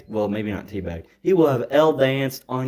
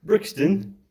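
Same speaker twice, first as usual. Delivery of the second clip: higher, hammed-up London lad accent; mock London accent; 4 semitones higher, 3 dB louder than usual. Needling his cohost about Brixton, in a mock London accent. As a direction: quiz him like a cheeky London lad, in a London accent. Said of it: "You're not doing the accent anymore".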